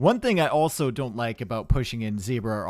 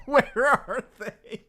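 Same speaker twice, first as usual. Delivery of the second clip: laughing; 10 semitones higher, much slower than usual. Retold or imitated. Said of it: "Celeste is already thinking".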